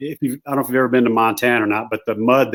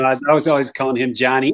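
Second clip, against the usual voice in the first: ordinary delivery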